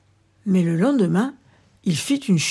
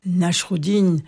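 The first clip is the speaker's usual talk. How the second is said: ordinary delivery, nothing marked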